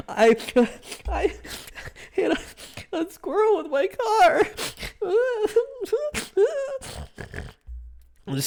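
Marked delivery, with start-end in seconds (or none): 0.1-4.4 high teary wail while quoting herself; imitating her own sobbing at work; retold crying. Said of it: "And I was like". 4.5-7.5 wailing laugh-sob; high laugh-cry. Retold or imitated. Laugh-cry after retold sob.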